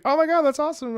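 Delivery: high-pitched thrilled reply; imitated delight. Streamer thanks a fan, high delighted voice.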